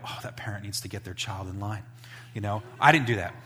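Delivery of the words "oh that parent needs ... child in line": judgmental adult. Retold or imitated. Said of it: "walk by and go".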